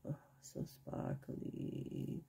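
breathy hushed whisper. Real, not acted. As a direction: whisper it in awe.

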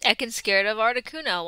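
ordinary talk, nothing marked

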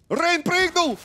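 high, loud call; excited call. Real, not acted. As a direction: call the jump in surprise. Caster at a jump.

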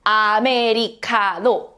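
Americano slowly split as "a-me-ri-ka-no"; split into syllables. Shown as a demonstration. Teacher shows how Hangul splits words, slow syllable-by-syllable Americano. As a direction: break Americano into separate Korean syllables, slowly. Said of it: "split the words like this".

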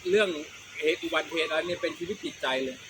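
normal talking voice

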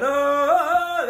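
loud high Tarzan yell; hollered jungle call. Retold or imitated. Woman on the bus imitating Tarzan, loud jungle yell. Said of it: "when she did the Tarzan".

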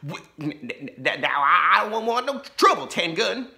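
high, fast stammering sheriff; frightened stutter. A narrator's reading. Laura's dad stammers at the outlaw in fear. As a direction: stumble over every word in fear. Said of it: "stuttered Laura's dad".

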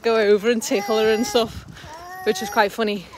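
ordinary delivery

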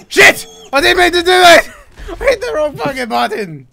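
loud, high panicked outburst breaking into laughter; real flustered shriek over a blunder; losing it. Real, not acted. Player hit the wrong button, losing it. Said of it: "you totally lost it there".

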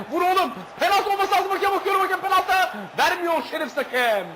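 high, loud heated penalty shouts; real worked-up shouting; excited appeal. Real, not acted. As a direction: demand the penalty at the screen.

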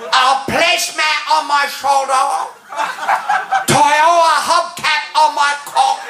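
high mock-Japanese accent, halting; ranting Japanese businessman. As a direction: keep up a high caricatured Japanese rant. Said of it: "and then he keeps going".

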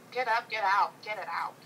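usual speaking voice

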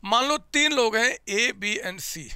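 normal talking voice

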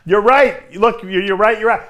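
ordinary delivery

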